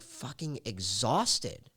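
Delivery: ordinary delivery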